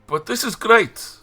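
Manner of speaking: high, slow, delighted; excited. Acted. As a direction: beam it out loud and high. Excited NPC boy.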